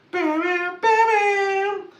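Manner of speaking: very high, loud brassy "bam bam"; film theme imitation. Joking about double oh seven, imitated theme sting. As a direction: sing out a mock theme sting.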